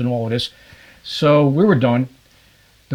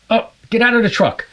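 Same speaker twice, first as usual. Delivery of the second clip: excited call; 8 semitones higher than usual. Retold or imitated. Spotting fish, urging clients out.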